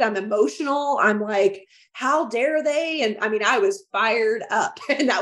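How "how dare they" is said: slow, high indignant how dare they; a touch of mock fury; retold outrage. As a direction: let the outrage stretch each word. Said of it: "I'm ticked. I'm pissed".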